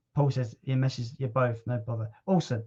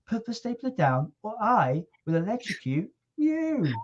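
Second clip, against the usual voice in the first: high-pitched mock-menacing cartoon creature voice; imitating Pikachu; 8 semitones higher than usual. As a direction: play a tiny cute creature making a mock threat, pitched way up. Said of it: "the way he delivered his dialogue as Pikachu".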